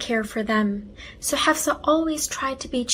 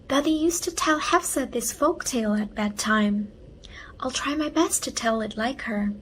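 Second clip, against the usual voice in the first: plain reading voice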